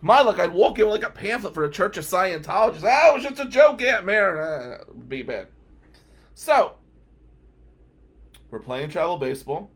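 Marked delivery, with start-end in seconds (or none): 2.8-4.4 loud, higher mock-panicked excuse; hasty excuse. Nephew excusing himself to his aunt, mock panic. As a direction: blurt it out to placate her.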